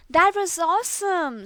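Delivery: put-on high, loud delighted boy; thrilled. A narrator's reading. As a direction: gush with delight. Boy thrilled after landing.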